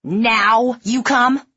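slow, loud, high furious taunt; bitter accusation. A narrator's reading. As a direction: throw it at them bitterly. Woman berating late marines.

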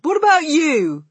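high, loud retort; indignant. A narrator's reading. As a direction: throw the question back loudly. Bruno turns the problem back.

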